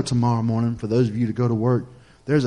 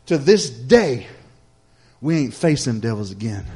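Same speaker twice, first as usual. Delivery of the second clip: normal talking voice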